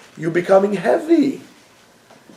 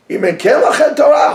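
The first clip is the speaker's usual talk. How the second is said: shouted quote; 9 semitones higher, 3 dB louder than usual. Real, not acted. Quoting famous saying, shout.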